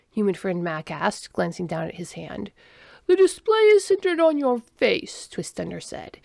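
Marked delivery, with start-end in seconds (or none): 3.1-5.3 high, loud alien voice; alien explaining. A narrator's reading. Alien tells human about his face, explaining. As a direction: point it out formally, pitched well up.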